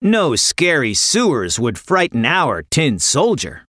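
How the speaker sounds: ordinary delivery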